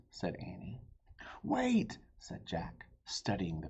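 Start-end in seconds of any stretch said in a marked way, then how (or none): 1.4-2.0 urgent call. Acted. Jack calling Annie back.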